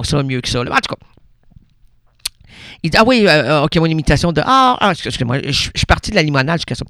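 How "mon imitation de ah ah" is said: ordinary delivery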